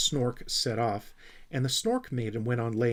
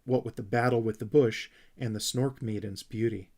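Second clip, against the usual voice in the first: ordinary delivery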